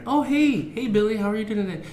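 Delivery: put-on high sing-out greeting to a little kid; parent greeting a child. Another parent greeting Billy, high-pitched mock-cheery greeting.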